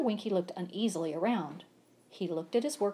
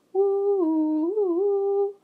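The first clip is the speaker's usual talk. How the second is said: high loud hooting woo; long high train whistle woo; 13 semitones higher, 9 dB louder than usual. A narrator's reading. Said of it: "The train whistled".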